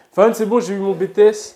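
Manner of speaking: excited announcement. Real, not acted. Announcing to someone new.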